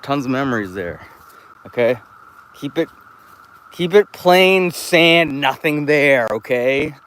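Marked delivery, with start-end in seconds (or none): 2.5-6.9 higher, louder and slow on keep it plain sand; mock pleading demand. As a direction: drag it out like a whiny demand. Demanding the pit stay.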